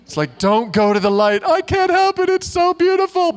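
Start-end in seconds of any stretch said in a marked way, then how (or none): 0.4-1.4 louder, higher cartoon warning; cartoon bug warning. Preacher quotes Bug's Life moth warning friend, cartoon alarm. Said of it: "the movie Bug's Life from Pixar". 1.5-3.3 very high, loud cartoon squeal; entranced cartoon bug. Preacher plays Bug's Life moth drawn to flame, entranced squeal. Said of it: "the movie Bug's Life from Pixar".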